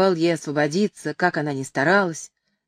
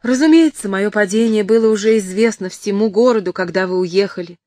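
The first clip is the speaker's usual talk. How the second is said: high, loud opening, hard and accusing; dry, reproachful; 4 semitones higher, 6 dB louder than usual. A narrator's reading. Woman answering her former lover about her downfall, reproachful. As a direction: throw it at him as a harsh reproach. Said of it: "отвечала госпожа де Реналь сухим тоном".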